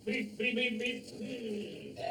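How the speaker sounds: put-on high playful beep beep for a child; car horn noise. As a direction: toot like a toy car to amuse the boy. Playing toy cars with a child.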